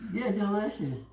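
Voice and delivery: talking while chewing; words round a bite of food; mouth full. Real, not acted. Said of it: "always talk with my mouth full".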